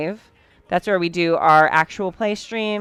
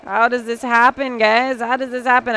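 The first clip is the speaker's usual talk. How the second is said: higher, louder exasperated question; exasperated disbelief; 5 semitones higher, 3 dB louder than usual. Real, not acted. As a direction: ask it in tired disbelief. Shocked at late hour.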